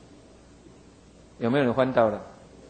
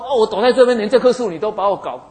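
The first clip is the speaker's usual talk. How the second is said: much higher and louder, furious complaint; angry outburst; 8 semitones higher, 8 dB louder than usual. Retold or imitated. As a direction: yell it out at God, fed up. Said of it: "哦，更气，". (约拿气得大声抱怨)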